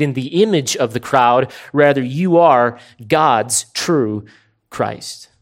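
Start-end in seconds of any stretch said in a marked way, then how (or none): 1.7-5.3 slow emphatic declaration. Acted. Preacher stressing the confession.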